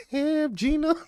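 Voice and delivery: high, loud quoted exclamation; a mock Martin-style exclamation; mock exclaim. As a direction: blurt it out in a high voice. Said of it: "He said".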